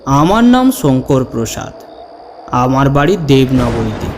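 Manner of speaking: ordinary delivery, nothing marked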